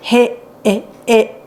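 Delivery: put-on young reader sounding out; sounding out a word. A narrator's reading. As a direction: stretch each sound like a beginner reader. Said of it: "she said".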